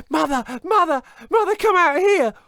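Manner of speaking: put-on high loud boy's panicked call; Jack shouting. Jack calling his mother from the beanstalk, shouting. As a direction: yell for mum in panic. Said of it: "But now he was shouting".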